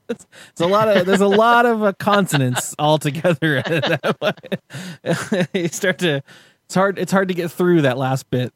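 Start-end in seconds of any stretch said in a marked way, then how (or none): none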